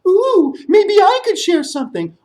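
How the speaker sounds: very high bat voice; squeaky bat. Acted. Bat flying down calling to the storyteller in a high squeak. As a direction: chirp it like a small bat.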